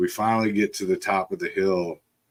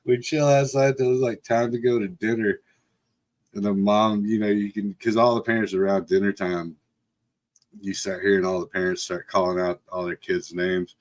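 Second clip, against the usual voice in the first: plain reading voice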